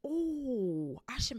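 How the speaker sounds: a breathy ooh then a hushed aside; excited whisper. Real, not acted. Reader whispering an aside about making it. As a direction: whisper the idea to yourself.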